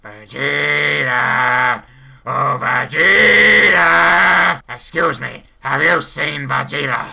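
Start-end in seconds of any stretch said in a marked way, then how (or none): 0.0-4.6 slow, drawn-out shout; calling out. Acted. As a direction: call across a crowd, long vowels. Calling out for Vegeta.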